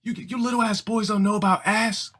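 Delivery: high, offended mock girl; a girl fires back at the boys; indignant girl.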